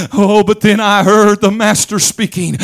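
ordinary talk, nothing marked

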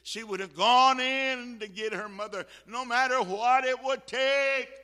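full-force preaching. Real, not acted. Preacher on a daughter's resolve to save Mother, full-force preaching. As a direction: drive it home at full volume.

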